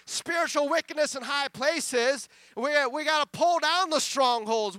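loud, driving sermon delivery; preaching. Real, not acted. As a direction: preach it forcefully to the congregation. Preaches at full force.

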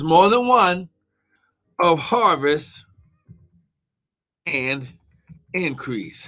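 slow, word groups split by long pauses; dictating while typing. Real, not acted. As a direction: say it piece by piece as you type. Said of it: "Let me just type that".

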